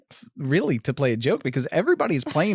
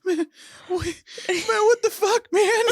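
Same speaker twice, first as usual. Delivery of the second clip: very high, panicky imitation; mock freak-out; slower than usual. Retelling the character freaking out, high mock panic. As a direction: squeal it as if losing it. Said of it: "'cause he's freaking out".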